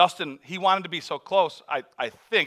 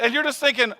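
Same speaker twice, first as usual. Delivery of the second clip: ordinary talk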